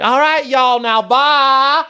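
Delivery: high, loud Louisiana goodbye; Louisiana lady. Retold or imitated. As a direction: sing out a Louisiana see-ya. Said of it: "she would talk like this".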